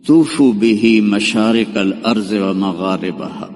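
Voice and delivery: solemn, slow intoned Arabic; slow, solemn Arabic recitation. Acted. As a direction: intone it as a divine call. Voice from the cloud, Arabic.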